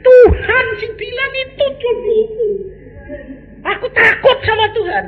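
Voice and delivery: high, half-laughing wife's confession; lightly voiced wife. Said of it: "Dia bilang".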